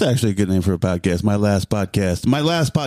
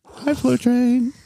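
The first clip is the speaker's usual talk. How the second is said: squeaky greeting; much slower than usual. Acted. High slow greeting.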